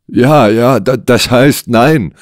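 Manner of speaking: high, flustered stammer. Acted. Client caught out by the detective's question, stammering. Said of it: "stammelte Lennox".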